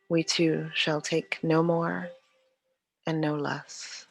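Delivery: normal talking voice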